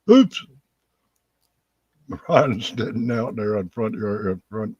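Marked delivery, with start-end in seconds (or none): 0.1-0.4 very high, loud "Oops"; comic oops. Real, not acted. Teller after a face plant in snow, high comic oops. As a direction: squeak it out after falling.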